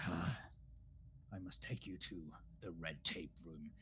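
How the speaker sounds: low, soft, sighing escort; resigned character. Acted. Luresh must take them to red tape room, resigned.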